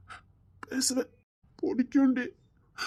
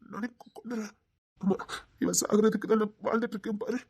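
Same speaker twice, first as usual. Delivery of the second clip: ordinary talk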